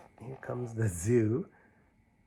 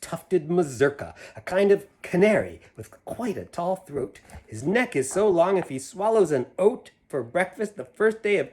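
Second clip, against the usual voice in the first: put-on lively read-aloud to kids; animated picture-book reading; story narration; 7 semitones higher, 8 dB louder than usual. A narrator's reading. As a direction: read the rhyme with a bouncy lilt. Reads Seuss poem to children.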